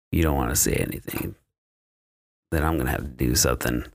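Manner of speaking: low, quick, understated letdown; retold disappointment. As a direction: act out being let down, pitch dropped. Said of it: "he's fucking disappointed".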